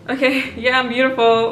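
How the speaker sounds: unconvinced self-talk. Retold or imitated. Vlogger retelling her awkward first affirmation, unconvinced. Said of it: "I felt so weird".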